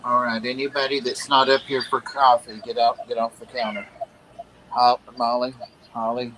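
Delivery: ordinary delivery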